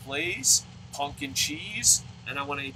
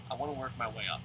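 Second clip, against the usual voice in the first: the same line again, softer to himself; quiet repeat; 2 semitones lower, 8 dB quieter, much faster than usual. Real, not acted. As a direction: murmur it again as a private reminder.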